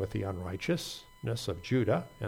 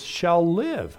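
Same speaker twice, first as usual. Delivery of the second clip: SHALL LIVE lifted higher and louder; stress on SHALL LIVE; 4 semitones higher, 5 dB louder than usual. Shown as a demonstration. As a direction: punch "live" with a high, falling tone. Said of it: "The emphasis is on the words".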